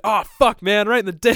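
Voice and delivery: loud, high cry of comic outrage; mock pained outburst. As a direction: yelp it like a cartoon character taking a hit. Acting out Pikachu getting hit.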